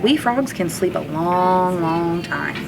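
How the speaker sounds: plain reading voice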